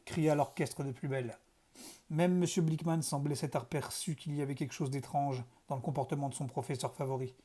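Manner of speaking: ordinary delivery, nothing marked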